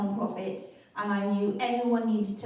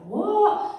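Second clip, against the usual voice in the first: surprised what; 10 semitones higher, 5 dB louder than usual. Retold or imitated. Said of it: "and I was like".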